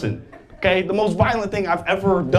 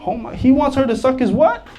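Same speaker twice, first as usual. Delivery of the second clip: shocked; 7 semitones higher than usual. Retold or imitated. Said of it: "I'll be like".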